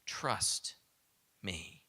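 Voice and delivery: soft, breathy hush of God answering; hushed divine voice. Acted. God answering Moses's doubts, hushed. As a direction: let it fall to a near whisper. Preacher voices God's reply.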